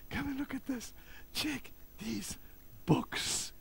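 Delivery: excited whisper with squeaks of wonder; very soft, breathless marvelling; imitating hushed awe. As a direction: whisper in amazement, as if at treasure. Pastor marvels, calling wife to books.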